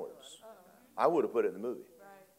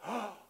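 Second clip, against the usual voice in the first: startled gasp; 9 semitones higher, 8 dB quieter than usual. Retold or imitated. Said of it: "she looks up and goes".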